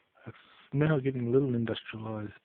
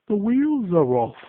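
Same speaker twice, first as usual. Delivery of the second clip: loud, slow, higher call; urgent repeated shout; shouted warning; 9 semitones higher, 12 dB louder, slower than usual. Retold or imitated. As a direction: yell it slowly over the engine noise.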